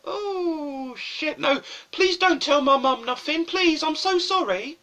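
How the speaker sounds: high, hammed-up begging; whiny, high-pitched frantic apology; panicked pleading. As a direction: plead in mock panic with the teacher. Pupil begs the threatening teacher.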